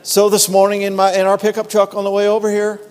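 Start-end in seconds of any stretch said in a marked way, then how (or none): none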